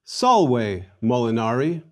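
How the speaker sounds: salve molinari slowly as SAHL-way moh-lee-NAH-ree; model pronunciation. Shown as a demonstration. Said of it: "Please repeat after me".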